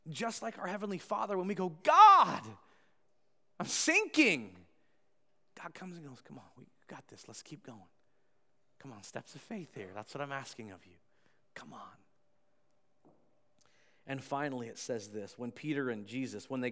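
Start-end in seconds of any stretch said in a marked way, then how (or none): 1.8-4.6 loud, high call to God, acted; hammed-up plea, starting on a big shout; panicked cry for help. Acts out crying out to God. 6.2-12.0 soft, low, unhurried encouragement; gentle fatherly nudge, dropping softer; calm reassurance. Acted. God reassuring the one sinking.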